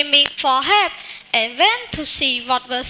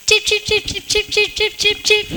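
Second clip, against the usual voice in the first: bird chirps; 5 semitones higher, faster than usual. Acted. Bird flies away chirping.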